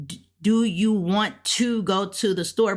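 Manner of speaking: every word separate, "want to" fully sounded; deliberate, unblended "want to"; English learner's careful speech. Acted. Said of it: "when somebody's learning English, they'll probably be like".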